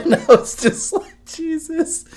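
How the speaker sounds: high-pitched words through laughter; amazed and laughing, voice pushed up high; cracking up. Real, not acted. Dad reacting to his baby's scream, cracking up. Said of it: "it was fucking hilarious".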